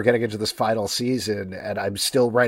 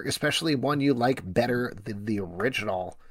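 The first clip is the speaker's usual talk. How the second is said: ordinary delivery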